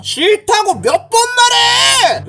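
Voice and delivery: high, drawn-out angry shout; exasperated shout. Acted. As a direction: shout your refusal, stretching the end. Fed-up man refuses the bully. (높게 늘여 버럭 화내는 말투)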